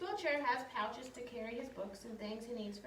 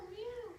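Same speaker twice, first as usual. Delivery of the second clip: kitten meow; 10 semitones higher than usual. Acted. Cat sound in picture book.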